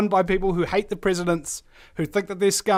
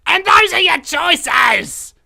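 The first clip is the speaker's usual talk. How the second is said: slow, much louder and higher outburst; exasperated shout; 9 semitones higher, 8 dB louder, much slower than usual. Real, not acted. Radio host ranting at listeners about the candidates, slow exasperated shout. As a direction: throw it at the listeners in disbelief.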